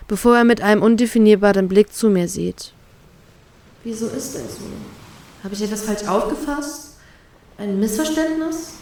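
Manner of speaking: usual speaking voice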